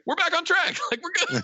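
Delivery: high-pitched, elated retelling; triumphant mock cheer. Snake after hanging up the phone, mock triumphant.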